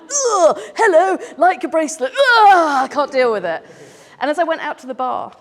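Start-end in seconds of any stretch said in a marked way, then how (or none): 0.0-3.6 high, loud squirming cringe with a nervous laugh; embarrassed Brit gushing in a high panicked voice; awkward British cringe. Retold or imitated. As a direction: squirm through it, mortified and laughing. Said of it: "as me, I'm British, terribly awkward".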